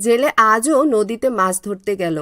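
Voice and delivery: low, even storyteller voice; narrating a village folk tale; story narration. A narrator's reading. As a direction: tell the tale calmly to listeners. Narration resumes.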